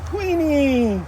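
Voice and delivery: high, drawn-out call of the name; high pet call. Real, not acted. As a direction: sing out to the bird. Calling the parrot by name.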